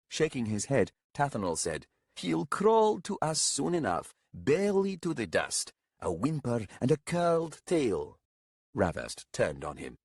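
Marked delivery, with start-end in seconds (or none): 2.2-8.2 high, smug prediction; mocking old companion. A narrator's reading. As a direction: deride the pup in a raised, theatrical voice.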